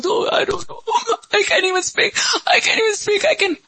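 very high, squeaky mock-sobbing voice; crying, barely speaking. Preacher acts someone cried out before God, high mock sobbing. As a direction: act too choked with tears to get words out. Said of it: "cry to the point where, like, you can't even pray".

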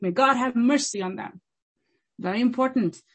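usual speaking voice

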